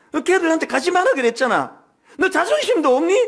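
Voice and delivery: mock-scold the child like his mother; retold scolding. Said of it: "막 야단을 쳤대요".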